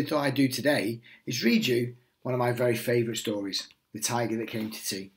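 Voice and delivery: plain reading voice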